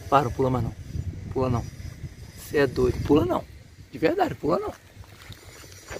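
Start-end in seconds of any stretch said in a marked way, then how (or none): 3.0-4.7 alarmed warning. Real, not acted. Warning someone not to jump.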